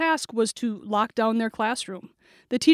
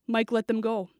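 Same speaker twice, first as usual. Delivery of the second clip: ordinary delivery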